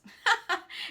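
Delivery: high, loud jolly chuckle; acted chuckle. Man tickled by his slide, hearty acted chuckle. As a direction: chuckle in character, pleased with yourself. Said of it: "added with a chuckle".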